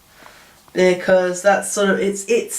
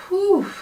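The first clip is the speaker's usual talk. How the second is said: strong mint; 11 semitones higher than usual. Real, not acted. Reacting to strong mint tea.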